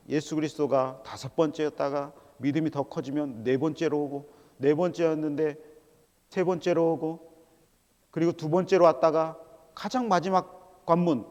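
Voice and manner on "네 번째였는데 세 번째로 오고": ordinary talk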